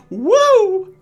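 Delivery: high, loud "Woo!"; loud celebratory cheer; excited whoop. Real, not acted. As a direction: whoop it up for the big introduction. Hyping the player's campaign debut.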